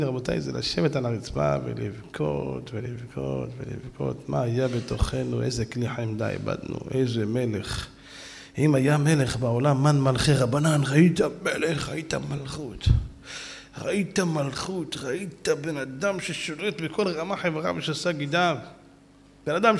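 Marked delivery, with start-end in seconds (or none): 0.0-7.9 low, soft, slow lament; mournful lament. Real, not acted. Mourning the lost rabbi. 10.9-18.1 reverent. Real, not acted. Extolling the rabbi's majesty.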